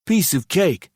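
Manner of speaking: Ed faking confidence for his friend, loud and overdone; exaggerated vigor. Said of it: "said with exaggerated vigor".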